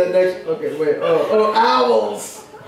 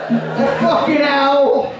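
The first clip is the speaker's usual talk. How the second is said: lightly voiced poet, pitched higher, indignant; poet guy's voice; 6 semitones higher than usual. A lightly done poet voice reciting his nature poem, indignant. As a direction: read the poem as the odd poet character, ranting at squirrels. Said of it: "this guy who talks like this".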